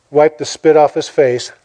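ordinary talk, nothing marked

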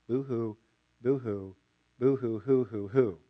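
quiet, hammed-up fake sobbing boo-hoos; mock boo-hoo crying. Preacher mocking self-pity with fake boo-hoo sobs. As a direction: pretend to whimper like a crybaby.

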